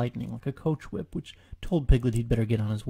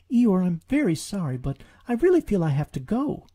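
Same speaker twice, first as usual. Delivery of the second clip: high, louder anxious apology; apologetic Piglet; 6 semitones higher, 4 dB louder than usual. Acted. Piglet excusing himself to Eeyore, high and anxious. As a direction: say sorry small and urgent.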